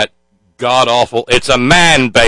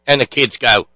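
ordinary talk

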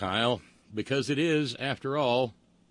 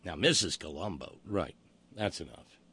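ordinary talk, nothing marked

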